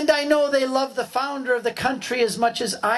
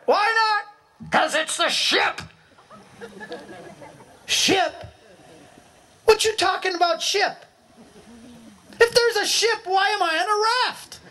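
high-pitched disbelief shouted back; incredulous high calls; 11 semitones higher than usual. Acted. Raft dweller shouting back in disbelief about ship. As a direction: call back in astonished disbelief.

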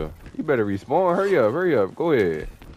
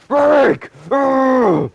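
high, loud yell for his teammate; frantic yell; 11 semitones higher, 8 dB louder than usual. Real, not acted. Gamer yells to teammate Rick mid-fight. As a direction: shout for Rick, then a loud groan.

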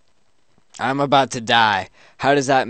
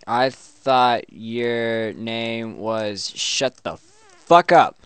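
mock-angry comeback; 4 dB louder, much slower than usual. Player renaming the chatbot as an insult, mock-angry. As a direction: draw it out, then punch the last words.

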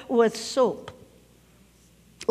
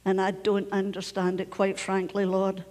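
low, soft plea to the Lord; private prayer; 5 semitones lower, 6 dB quieter than usual. Acted. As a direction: pray quietly and frankly, as if alone. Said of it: "you can say these words".

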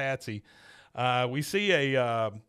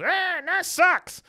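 very high, slow, drawn-out jeer; hammed-up falsetto heckle; mocking passerby; 7 dB louder, much slower than usual. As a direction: sing it out like a sneering stranger from a car. Passerby heckles Hank from car.

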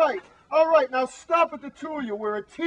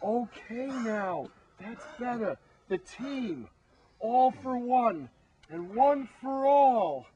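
calm rally; slower than usual. Acted. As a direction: settle them, then rally slowly. Team calms down, rallying.

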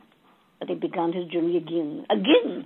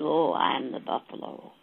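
a lightly lowered, softer buffalo voice; dying buffalo; 5 semitones lower, 4 dB quieter than usual. A narrator's reading. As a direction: speak low and weak as the buffalo. Buffalo voiced in told story.

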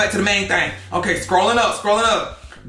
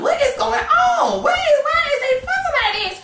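mock bewildered shriek. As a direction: shriek out baffled, like a shocked auntie. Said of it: "And so I'm just like".

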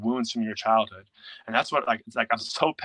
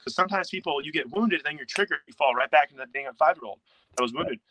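ordinary talk

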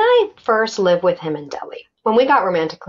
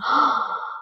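a short breathy intake of shock; a retold, startled gasp; sharp gasp; 6 dB quieter than usual. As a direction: gasp like you just got struck. Shocked by his angry face.

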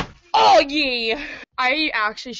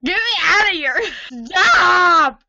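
loud, higher protest at the camera; yelling in protest; 5 semitones higher, 7 dB louder, slower than usual. Real, not acted. Filmed by her friend, she yells stop.

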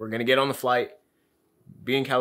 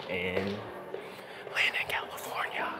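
whispering; 7 dB quieter, much slower than usual. Real, not acted. Drops to whisper.

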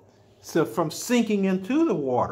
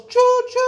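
much higher, louder train choo-choo; 7 dB louder than usual. Pretend. Showing a child the train, a high loud choo-choo. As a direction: toot it like a toy train.

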